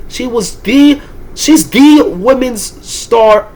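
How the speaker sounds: too frustrated to get the words out; frustrated sputtering. Real, not acted. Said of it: "I can't even speak because I'm so frustrated".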